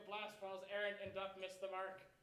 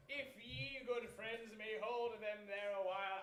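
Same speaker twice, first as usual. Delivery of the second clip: ordinary delivery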